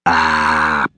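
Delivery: low growled grunt. Acted. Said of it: "Pops growled".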